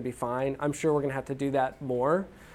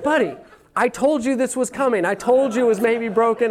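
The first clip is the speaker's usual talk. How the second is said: raised, loud retold dad protest; exasperated scolding; 7 semitones higher, 5 dB louder than usual. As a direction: scold your kid in exasperation, higher and louder. Exasperated dad scolding bawling son.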